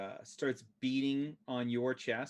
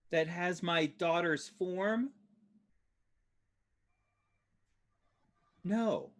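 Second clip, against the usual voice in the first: high, slow, drawn-out disbelief; appalled mother; 7 semitones higher, slower than usual. Acted. Mother disowns the withered tree thing, high and appalled. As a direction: draw the words out high in horrified rejection.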